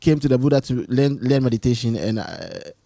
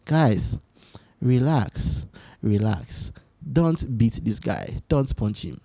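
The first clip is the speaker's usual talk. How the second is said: softer, slowing calm reassurance; calm Buddha; 4 semitones lower, 6 dB quieter, much slower than usual. Retold or imitated. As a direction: settle the angry disciples gently. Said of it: "Then Buddha told them that".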